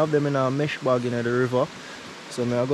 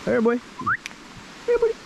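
squeaky praising a dog; 11 semitones higher, faster than usual. Real, not acted. Greeting the dog Enzo.

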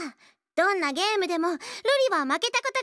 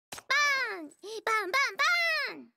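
gunshot noises. Acted. Playing at shooting a gun.

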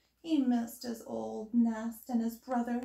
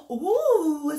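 delighted hedgehog; 4 semitones higher, 9 dB louder than usual. A narrator's reading. Hedgehog welcomes Owl.

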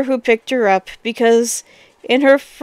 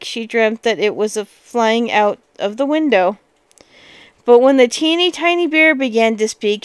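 ordinary delivery, nothing marked

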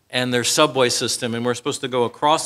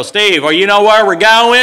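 worried tourist; 6 semitones higher, 10 dB louder than usual. Retold or imitated. Tennessee guys asking in subway.

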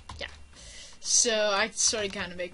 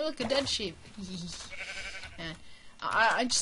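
ordinary delivery

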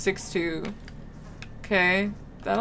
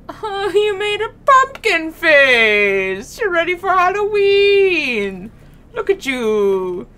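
high, loud, drawn-out gushing; gushing delight; 7 semitones higher, 7 dB louder than usual. Pretend. Player fussing over a little pumpkin-face artiste, gushing delight. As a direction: coo loudly, stretching the words out.

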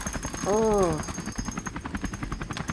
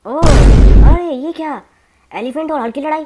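big surprise; 8 semitones higher, 12 dB louder than usual. Real, not acted. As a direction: stretch out the shock loudly. Sees elephant and Hulk fight.

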